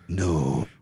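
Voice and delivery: dropped low, drawn-out demo; super deep voice. Shown as a demonstration. Guest demonstrating the super deep voice, low and drawn out. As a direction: sink the word down into a deep hum. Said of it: "it's, like, the super deep voice".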